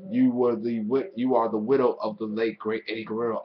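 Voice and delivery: usual speaking voice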